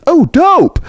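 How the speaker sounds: high, loud thrilled exclamation. Retold or imitated. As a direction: imitate a pop star's delighted whoop. Acts out [name]'s thrilled exclamation.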